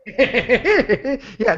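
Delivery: high cartoon woodpecker cackle before "Yeah"; Woody Woodpecker laugh. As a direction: rattle out a high, goofy cartoon-bird laugh. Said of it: "Was that Woody Woodpecker laugh that you just did?".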